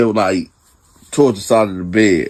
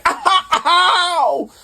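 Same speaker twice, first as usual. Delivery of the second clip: mock yelp. Cellmate yelps when kicked.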